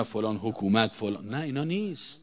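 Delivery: ordinary delivery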